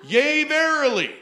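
loud, high prophetic intoning; hammed-up preacher proclaiming; mock prophetic declaration; mock prophecy. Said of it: "which if you've been in church charismatic circles".

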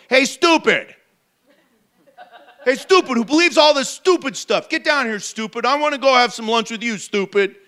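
mock him calling Zacchaeus stupid; hammed-up insulting shout; mock rude Jesus. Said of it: "he doesn't say that".

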